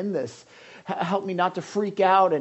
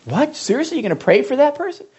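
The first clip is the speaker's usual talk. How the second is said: loud, higher and fast disbelief; incredulous; 9 semitones higher, 9 dB louder than usual. Acted. Preacher mocking a doubter's shock at praying for enemies. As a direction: act shocked at the idea.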